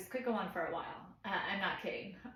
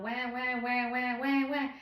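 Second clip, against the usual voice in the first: repeated higher "wah", building louder; loud mock baby cry; 4 semitones higher, 5 dB louder than usual. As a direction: imitate a baby's insistent screaming. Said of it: "the screams are still loud".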